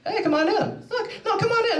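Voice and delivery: high, coaxing mock reporter voice; Chris Hansen. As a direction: imitate a host beckoning in a high singsong. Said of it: "this reporter named Chris Hansen".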